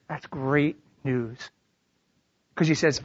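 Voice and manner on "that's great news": low, slow, soft reply; unhurried, quiet comfort; calm reassurance. Acted. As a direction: gently welcome the confession. God answers the confession calmly.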